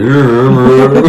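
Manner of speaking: mock slurred drugged voice, breaking into laughs; groggy patient laughing through her words; drugged and woozy, laughing. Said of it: "But apparently I was like".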